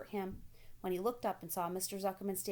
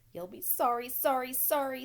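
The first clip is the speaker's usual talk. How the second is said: loud, higher goose warning; 6 semitones higher, 6 dB louder than usual. A narrator's reading. Goose warning Wilbur he will be sorry, loud. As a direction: warn him off, goose-like. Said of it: "said the goose".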